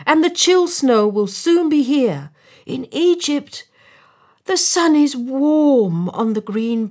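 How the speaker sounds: high, loud, expansive storybook bird; high-pitched rhapsodic pleading; protesting swallow. A narrator's reading. Swallow protests, describing Egypt.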